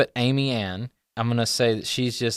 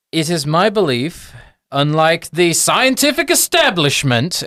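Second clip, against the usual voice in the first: raised, high, pointed declaration; pompous academic projecting a grievance; very loud gnome professor; 5 semitones higher, 6 dB louder than usual. Acted. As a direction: pitch it so the neighbouring offices hear. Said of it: "he says very loudly".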